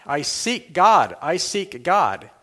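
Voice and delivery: slow, pleading cry of I seek God; a madman crying in the market. A narrator's reading. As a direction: call out slowly, searching. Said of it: "cried incessantly".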